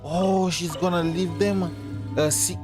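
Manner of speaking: ordinary talk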